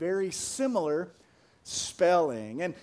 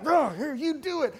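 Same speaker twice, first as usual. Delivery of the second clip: mock exasperation, pitched higher; higher-pitched, mildly annoyed retort; mad parent; 6 semitones higher than usual. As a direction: imitate a fed-up parent giving in. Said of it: "you get mad".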